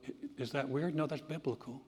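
ordinary delivery

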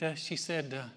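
ordinary talk, nothing marked

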